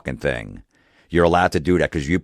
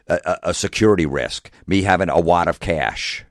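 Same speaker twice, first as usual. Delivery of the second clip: ordinary delivery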